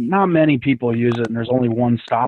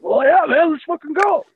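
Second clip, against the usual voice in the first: much higher, eager shout of agreement; hyped-up yes. Retold or imitated. Telling the stoned buddy to hit the highway, high eager voice. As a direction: egg your buddy on like it's the best idea ever. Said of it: "We're like".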